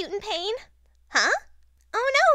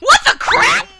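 high, loud, laughing shriek; shocked outburst; 10 dB louder than usual. Acted. Blossom sees Spider-Man, shrieking in shock. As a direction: burst out in stunned disbelief.

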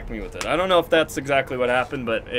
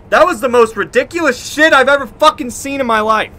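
loud, higher, outraged ranting; angry ranting; 9 semitones higher, 7 dB louder than usual. Real, not acted. Streamer venting to chat about a bizarre loss, loud angry rant.

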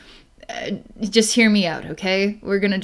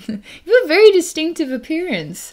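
higher, tickled, laugh bubbling under; amused laughing; 8 semitones higher, 4 dB louder, faster than usual. Real, not acted. Psychic describing client's odd look, amused laughing. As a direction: fight back giggles while saying it.

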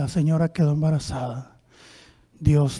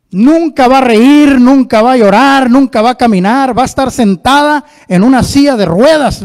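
preaching at full force; 8 semitones higher, 14 dB louder than usual. Acted. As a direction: hammer each nunca. Doctor's grim verdict, preached.

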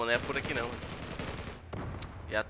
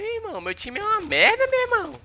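very high, strained complaint; high frustrated rant about the team; exasperated squeal. Real, not acted. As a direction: whine it high in disbelief.

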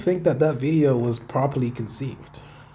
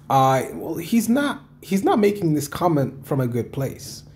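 ordinary talk, nothing marked

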